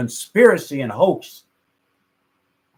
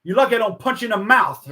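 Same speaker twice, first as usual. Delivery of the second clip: quick, raised retold threat; 6 semitones higher, 4 dB louder, faster than usual. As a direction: threaten him, imitating an irked star. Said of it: "[name] is annoyed".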